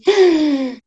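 sharp high gasp of fright. Acted. Storyteller acting the sisters' fright with a sharp high gasp. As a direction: gasp as the door swings open. Sisters open the forbidden door.

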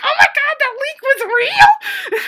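high, loud laughing shout of disbelief; freaked-out excited shout. Retold or imitated. Fan in the theater shouting as the leak proves real, laughing excited yell. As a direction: scream it like you just saw the spoiler come true. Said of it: "I freaked out".

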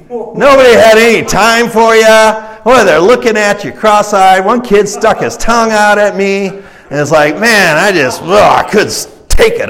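loud, high-pitched acted rant; exasperated traveler. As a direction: play a fed-up traveler venting about the locals. Disgruntled traveler rants about town.

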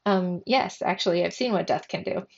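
ordinary delivery

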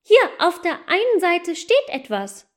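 put-on high, lively little goose girl; a young girl excitedly telling her brother; eager girl. Asa tells little brother Matz about the elf, eager and high. Children reading the shoe.

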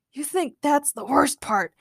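surly, fed-up growl. A narrator's reading. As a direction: grumble through your irritation. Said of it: "Logan growled".

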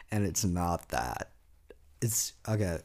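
sheepish whisper; embarrassed whisper to viewers. Real, not acted. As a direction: hush it out, flustered. Embarrassed about a sus remark.

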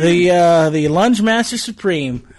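slow, announcer-style title; bestowing a grand title. Acted. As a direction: boom it out like a ring announcer. Grand title announcement over music.

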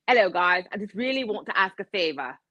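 imitated British company owner, pleading; British accent. Company owner asking customers a favor, mock British accent. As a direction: put on a British accent for a polite plea.

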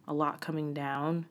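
ordinary talk

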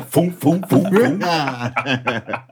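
breathy foom noises, rising then fading; whooshing sound effect. Retold or imitated. Acting out the tire commercial with whooshing foom noises. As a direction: toss out little whoosh sounds. Imitating tires thrown from afar.

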